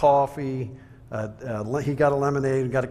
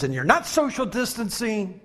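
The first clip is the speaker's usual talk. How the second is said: high-pitched scolding imitation; mock ranting; 7 semitones higher than usual. Angry customer scolding over distancing, mock ranting. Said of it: "he's ranting and he's raving".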